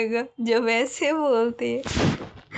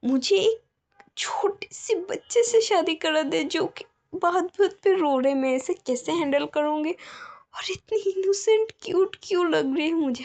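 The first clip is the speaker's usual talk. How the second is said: higher, exasperated grumble to herself; flustered alpha; 6 semitones higher than usual. Acted. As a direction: fret over marrying a child. (झुंझलाई हुई, ऊँची आवाज़ में बड़बड़ाहट)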